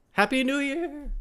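high, bright cheer; excited greeting. Real, not acted. Host opening the stream with a festive cheer. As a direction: shout it out like a New Year countdown.

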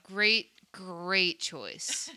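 drawn-out second "great", very slow; emphatic praise. Real, not acted. Host applauding the costume designers, slow stretched stress on "great". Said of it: "I can't stress that enough".

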